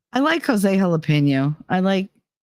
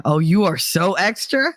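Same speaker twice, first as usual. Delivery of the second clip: ordinary delivery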